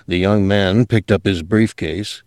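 ordinary delivery

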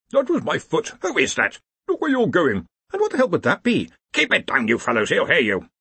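put-on squabbling old wizard voices; bickering wizards. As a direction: grumble at each other, high. Wizards bickering while invisible.

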